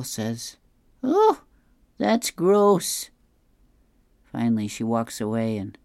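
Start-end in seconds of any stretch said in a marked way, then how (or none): 1.0-3.1 put-on high, loud disgusted old woman; disgusted Opal. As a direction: shriek of disgust at Earl.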